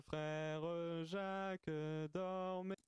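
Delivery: usual speaking voice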